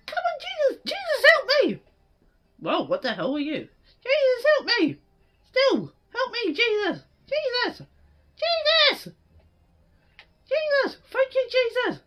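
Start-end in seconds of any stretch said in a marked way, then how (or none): 0.0-1.8 desperate shout. Real, not acted. Calling out for rescue. 4.0-11.0 desperate shout. Real, not acted. Calling out for rescue.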